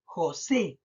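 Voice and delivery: José split, final SE held and falling; stress on SE. Shown as a demonstration. Teacher repeating the example for pupils, SE stressed in José. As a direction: separate JO, then lean on SE. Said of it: "no marco más la voz en jo".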